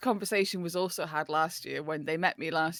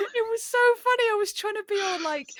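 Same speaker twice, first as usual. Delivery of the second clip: laughing; 6 dB louder, faster than usual. Real, not acted. Recalling funny moment, laughing.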